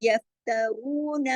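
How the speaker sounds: यफ्तरून slow, रू heavy and long; tajweed recitation. Shown as a demonstration. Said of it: "नून जबर ना सिर्फ एक हरकत". (यफ्तरून में रू मोटा और लंबा)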